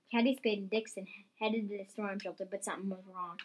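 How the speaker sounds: plain reading voice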